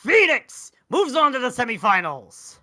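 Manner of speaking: slow, loud, high victory call; triumphant call. Real, not acted. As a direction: announce the winner big. Winner announced.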